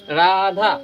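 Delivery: Radha with both vowels stretched; extra-long vowels. Shown as a demonstration. Teacher giving an example of prolonged vowels, Radha drawn out. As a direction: draw Radha out slowly, holding each आ. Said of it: "दुगुना मतलब दीर्घ समय लगता है".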